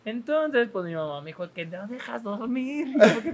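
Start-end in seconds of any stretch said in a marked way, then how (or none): 1.7-2.9 slow, drawn-out mock mom complaint, a touch higher; imitating mom. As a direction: drawl it like a tired, annoyed mom. Mom scolding cough again.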